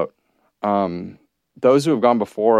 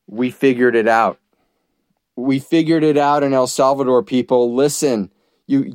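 raised, higher proclaiming voice; rousing call to the West; 5 semitones higher than usual. Retold or imitated. Host voicing the president urging the West, rousing. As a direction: call it out like a watchman waving a flag.

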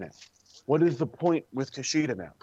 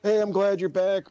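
higher, louder, bright fake hello; mock cheerful welcome; 6 semitones higher, 4 dB louder than usual. Fan greeting a returning wrestler, mock cheerful. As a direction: overdo a happy welcome back. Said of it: "I'm just like".

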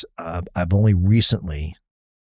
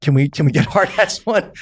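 laughing sheepishly; 9 semitones higher, 6 dB louder than usual. Retold or imitated. Sheepish retold request, laughing.

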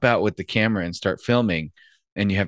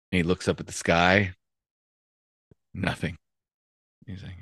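ordinary delivery

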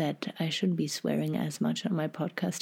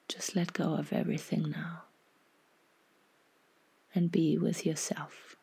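half-whispered, unhurried guidance; guided meditation. Acted.

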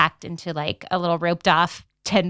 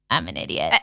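a much lower, flat "I'm an idiot"; self-reproach; 3 dB quieter than usual. Retold or imitated. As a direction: groan at your own mistake, dropping the voice. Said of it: "I was like".

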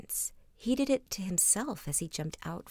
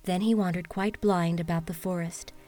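storybook narration; 7 dB louder than usual. A narrator's reading. Reads fairy tale aloud.

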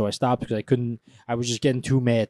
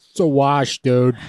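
loud, raised dismissive bro voice; mock defeatist bro; 3 semitones higher, 8 dB louder, slower than usual. Co-host joins in mimicking the naysayers, loud and mocking. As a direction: call it out like a fed-up bro.

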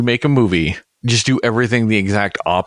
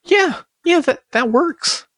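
mocking clueless producer. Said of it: "somebody listened to this and went".

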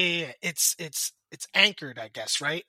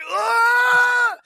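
high loud grunt of effort; straining yell; 8 dB louder than usual. Retold or imitated. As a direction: heave on the rope with a yell. Straining to pull rope.

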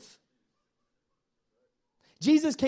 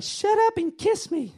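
high, slow, playful bride; 12 semitones higher than usual. Retold or imitated. Pastor voicing the bride to the groom, high and slow. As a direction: pitch it way up and drawl it like a giddy bride. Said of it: "she's like".

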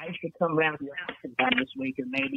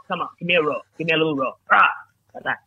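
ordinary talk, nothing marked

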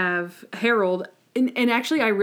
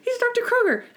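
much higher, frantic denial; mock panicked Monk; 7 semitones higher than usual. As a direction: deny it in a high panicky voice. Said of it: "And he's like".

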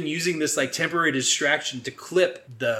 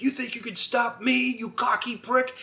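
high-pitched mock villain sneer; hammed-up menacing challenge; villain's taunt; 9 semitones higher than usual. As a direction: taunt the hero like a movie villain. Acts villain Orbison taunting Joe.

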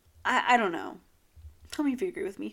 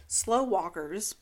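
normal talking voice

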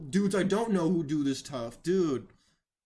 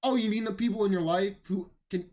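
usual speaking voice

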